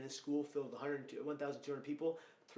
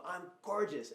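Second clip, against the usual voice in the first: imitating a cheering crowd, raised and higher; mock crowd scream; 5 semitones higher, 6 dB louder, slower than usual. Kids ending their affirmations together, mildly imitated scream. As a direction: call it out proudly, short of a scream. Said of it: "screaming at the top of their lungs".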